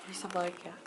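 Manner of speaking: ordinary talk, nothing marked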